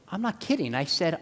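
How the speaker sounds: ordinary talk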